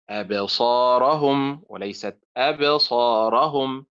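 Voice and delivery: أبصارهم then أبصارهم, slow, wrong one split after أبصى; teacher modelling right and wrong; slow contrast demo. Shown as a demonstration. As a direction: draw out both readings of أبصارهم. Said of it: "أبصا فعل وليست أبصا".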